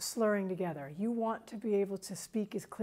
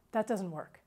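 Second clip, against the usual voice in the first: ordinary talk, nothing marked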